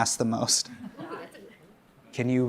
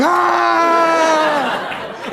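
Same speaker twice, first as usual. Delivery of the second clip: long high-pitched scream; mock pained scream; 13 semitones higher than usual. Comedian reenacting alcohol sting from wife, mock pained scream. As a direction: scream out high and sustained, hammed up. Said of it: "And I'll be like".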